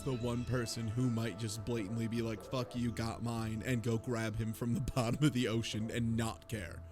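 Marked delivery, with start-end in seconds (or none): none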